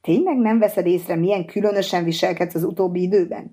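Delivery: ordinary delivery, nothing marked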